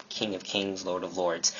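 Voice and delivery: understated, soft repeat of the titles; quiet restatement. Real, not acted. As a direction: say it low-key and softly.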